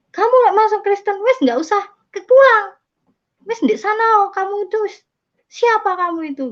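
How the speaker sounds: high-pitched scolding, acted out; angry rejection. Older sibling banishes the converted one from home, angry scolding.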